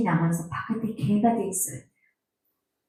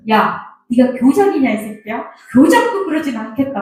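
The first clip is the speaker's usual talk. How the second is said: loud, higher-pitched mock telling-off; retold scolding; 4 semitones higher, 5 dB louder than usual. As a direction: scold a son, re-enacting an old quarrel. Acted quarrel with late son.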